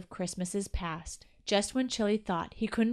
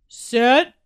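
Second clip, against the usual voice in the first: louder, building countdown call; eager countdown call; father calling out; 3 semitones higher, 11 dB louder than usual. A narrator's reading. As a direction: call it out to the family. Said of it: "Chili heard the father say".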